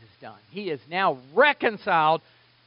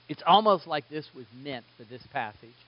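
ordinary talk, nothing marked

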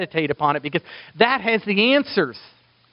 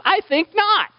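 much higher mock-incredulous; wry denial; 4 dB louder than usual. Preacher answering his own question, wry. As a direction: toss it off with a wry twist.

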